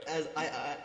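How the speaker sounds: ordinary talk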